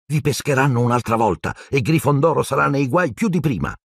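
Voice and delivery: high, lightly voiced boy's warning; insistent boy. A narrator's reading. Neville warns his friends, insisting. (ragazzino insistente, voce più acuta)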